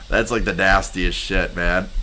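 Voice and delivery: usual speaking voice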